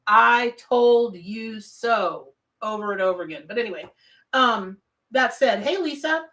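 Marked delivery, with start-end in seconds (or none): 0.0-2.3 very slow, drawn-out smug gloat; playful, self-satisfied crowing, word by word. Pretend. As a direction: savor each word smugly. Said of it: "this is so smug".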